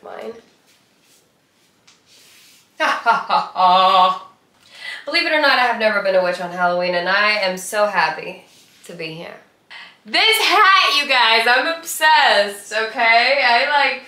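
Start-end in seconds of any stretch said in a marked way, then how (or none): 2.8-4.3 witch cackle. Pretend. 10.0-14.0 excited. Real, not acted. Excited about witch hat.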